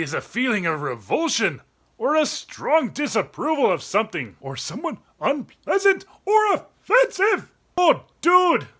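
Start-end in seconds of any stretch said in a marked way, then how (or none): none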